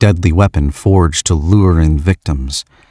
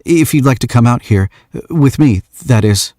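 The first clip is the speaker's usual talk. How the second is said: higher, halting then hurried offer; nervous; 7 semitones higher than usual. Acted. Shy young man invites her to stargaze, nervous. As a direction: stumble into the invitation. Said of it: "he says nervously".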